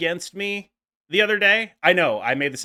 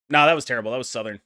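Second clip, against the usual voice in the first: usual speaking voice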